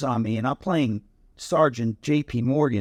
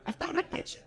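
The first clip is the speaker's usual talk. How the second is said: breathy giggling whisper, higher; whispered laugh; 11 dB quieter, faster than usual. Retold or imitated. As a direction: laugh it out under your breath. Friend admits the prank.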